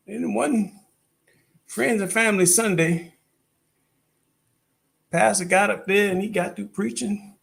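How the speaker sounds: high-pitched Southern storytelling drawl; drawn-out Southern accent. Acted. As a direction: build the church story in Southern twang. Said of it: "drawn Southern accent".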